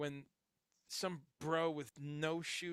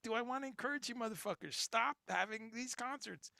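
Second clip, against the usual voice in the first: exasperated; 8 semitones higher than usual. Retold or imitated. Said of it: "I'm like".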